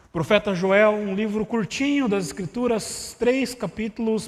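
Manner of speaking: ordinary talk, nothing marked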